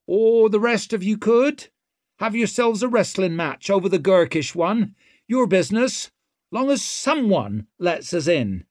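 swaggering rogue taunting; brash young woman. A narrator's reading. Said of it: "meaningful pause".

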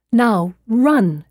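urgent cry, short of a full scream; narrated scream. A narrator's reading. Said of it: "she screamed".

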